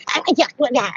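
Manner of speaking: garbled, high-pitched mock snarl; acted angry outburst; threatening witch. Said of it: "She said something along the lines like".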